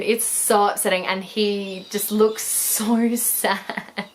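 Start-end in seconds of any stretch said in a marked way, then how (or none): none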